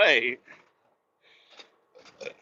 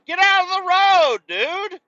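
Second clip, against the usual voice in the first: high, loud shout at a man in the road; real warning shout; calling out; 14 semitones higher, 7 dB louder than usual. Real, not acted. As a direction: yell him out of the way.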